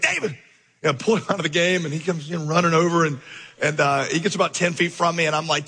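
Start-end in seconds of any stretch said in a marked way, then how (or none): 0.0-0.3 called out name. Retold or imitated. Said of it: "I was like".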